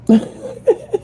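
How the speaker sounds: short high-pitched giggle; a quick bashful laugh. Real, not acted. Admitting to viewers she's territorial, short high giggle.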